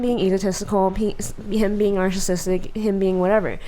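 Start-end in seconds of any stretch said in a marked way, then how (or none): none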